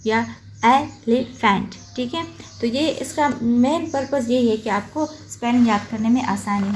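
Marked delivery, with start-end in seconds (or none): 0.7-1.8 एलिफेंट split ए, लि, फेंट; three separate beats in एलिफेंट; broken into pieces. Shown as a demonstration. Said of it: "टुकड़ों में करके".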